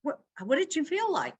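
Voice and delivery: high, quick mock astonishment; mock surprise. Counselor teasing a vague partner, hammed-up surprise. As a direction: pretend to be startled, pitch jumping up. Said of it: "I go".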